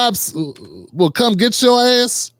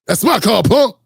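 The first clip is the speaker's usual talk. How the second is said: Debo voice. Retold or imitated. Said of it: "He starts sounding like Debo".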